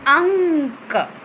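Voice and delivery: अंक drawn out slowly, the nasal ं held through the nose; slow nasal anusvara. Shown as a demonstration. Teacher models a word for her pupils, slow and nasal. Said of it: "अब इनको पढ़ो क्या लिखा है".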